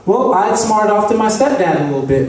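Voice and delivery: ordinary delivery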